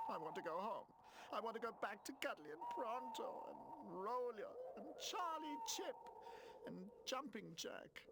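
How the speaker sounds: high pleading wail for his friends; homesick. A narrator's reading. Runaway toy Pino missing his friends, high pleading cry. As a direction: beg to go home, near tears.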